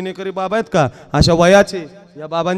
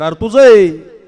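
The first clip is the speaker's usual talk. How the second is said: sudden louder outburst in a retold joke; startled realisation; 4 semitones higher, 12 dB louder, slower than usual. Husband realising the house is his own, startled blurt.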